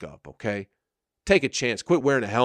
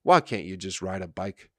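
usual speaking voice

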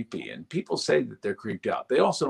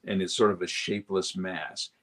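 usual speaking voice

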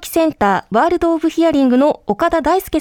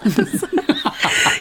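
embarrassed laugh; 3 semitones higher, 3 dB quieter than usual. Real, not acted. Teased by host on air.

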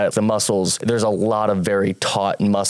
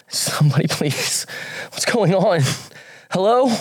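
high, rising frightened calls; scared cries for help; 10 semitones higher than usual. Acted. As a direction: call out lost and scared. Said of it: "tiny cries for help".

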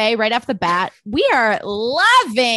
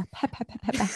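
pipe puffing noise; 10 dB quieter than usual. Acted. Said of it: "[name]'s pipe work".

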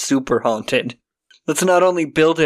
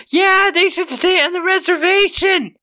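mock shouted old lady. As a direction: belt it out like a passing bigoted lady. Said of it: "just shouts out".

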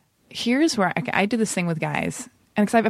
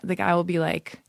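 ordinary delivery

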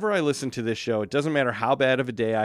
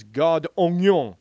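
reading French; 4 semitones higher, 6 dB louder, slower than usual. Real, not acted.

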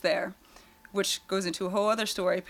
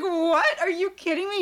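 high incredulous what; shocked disbelief; 9 semitones higher, 3 dB louder than usual. Retold or imitated. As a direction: react in pitched-up disbelief. Chris shocked being kicked out.

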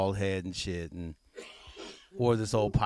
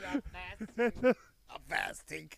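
laughing; 8 semitones higher, 6 dB quieter than usual. Pretend. Mocking the fasting joke.

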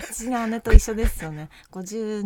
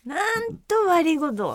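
higher-pitched Akita dialect demo; 8 semitones higher than usual. Shown as a demonstration. Showing how locals apologise, Akita dialect. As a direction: stretch out the Akita set phrase. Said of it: "秋田弁でもね".

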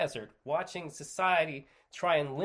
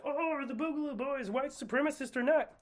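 high, mocking question voice; 11 semitones higher than usual. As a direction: toss the question off in a squeaky mock tone. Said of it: "the question of".

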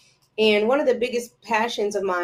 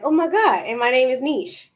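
higher, louder mock amazement; retold excited realisation; 4 semitones higher, 4 dB louder than usual. Podcaster recalling how she found her name, excited. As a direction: relive the sudden lightbulb moment.

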